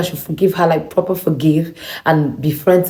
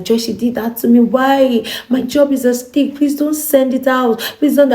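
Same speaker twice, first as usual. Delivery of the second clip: higher, imitating a frightened girl begging; mock-frightened begging, pitched up; scared pleading; 8 semitones higher than usual. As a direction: retell her panicky plea in a raised voice. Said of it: "She was all shaky, you know, was all scared".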